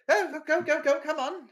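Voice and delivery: high, loud, flustered hurry-along voice; hurried receptionist. Acted. Receptionist hurrying visitors upstairs, high flustered voice. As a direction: usher them along in a bright rush. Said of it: "it's like".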